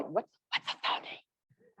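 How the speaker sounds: slow, hushed confused aside; puzzled whisper. Retold or imitated. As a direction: whisper it like a lost student. Voices puzzled audience member, whispering.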